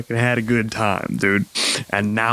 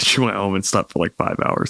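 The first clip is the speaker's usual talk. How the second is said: normal talking voice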